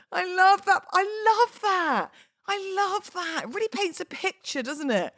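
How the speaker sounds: high, put-on gushing over her own poem; mock self-praise. Podcaster praises her own poem in a mock gushing voice. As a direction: coo about it like a proud fan.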